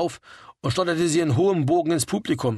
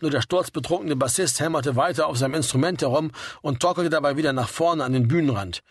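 ordinary delivery, nothing marked

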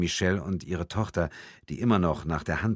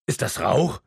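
higher, rising question on Rauch; dawning alarm, pitched up; startled question; 4 semitones higher, 4 dB louder than usual. A narrator's reading. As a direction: realise it mid-question, taken aback. Man realises there is smoke.

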